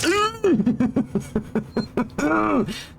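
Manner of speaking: squeaky high giggle; high nervous laugh. Real, not acted. Laughs mid-sentence.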